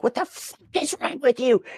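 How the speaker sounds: imitating screaming in a child's face; retold scream. Yelling at the kid to get off the couch, a high mock yell. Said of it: "I'm, like, screaming in this child's face".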